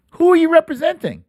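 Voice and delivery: "Who are you representing?" pitched high and rushed, in disbelief; exasperated repeat. Real, not acted. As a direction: fire the question back, exasperated. Repeated rhetorical question.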